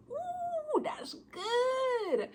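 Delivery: very high squealing "ooh" of delight. Real, not acted. Knitter showing viewers her new green, delighted squeal. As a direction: squeal over the yarn like a treasure.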